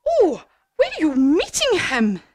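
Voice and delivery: high, loud owl question; alarmed owl. Acted. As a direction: ask with acted alarm. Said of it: "said Owl".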